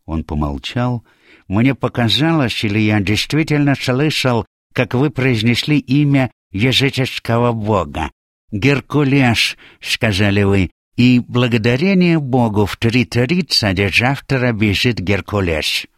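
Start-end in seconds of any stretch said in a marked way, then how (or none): 1.5-9.6 high voice with slurred sibilants; Atlas, marvelling bettor. Acted. Atlas recalling the pagan god's name, marvelling. 9.8-15.9 character voice with slurred sibilants; Atlas, excited bettor. Acted. Atlas naming tomorrow's runner Hercules, excited.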